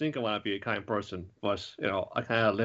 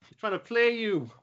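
higher-pitched urgent warning, a bit louder; 9 semitones higher, 3 dB louder than usual. Retold or imitated. Teammates warning Cure Grace about the monster's trick, high urgent call. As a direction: shout a warning to your friend not to fall for it. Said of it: "Everyone's saying".

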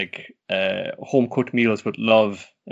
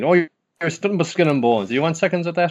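put-on higher, louder doting old granny; fussing grandmother; 7 semitones higher, 4 dB louder than usual. Granny pressing the skinny grandson to eat more, high and fussing. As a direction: push more food on him like a worried gran. Voicing the grandmother offering seconds.

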